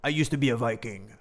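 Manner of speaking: a lightly voiced "Arr" of a burly Viking; mock Viking. Teacher giving old Capulet a mock Viking growl. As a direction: play a pirate-like old warrior. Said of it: "he would talk like that".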